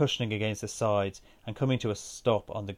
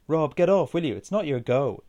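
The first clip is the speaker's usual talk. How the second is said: higher, louder exasperated plea; imploring; 6 semitones higher, 6 dB louder than usual. A narrator's reading. Aoife telling Rob to leave the pool table, imploring. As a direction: beg him to back off, voice raised. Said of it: "Aoife implored".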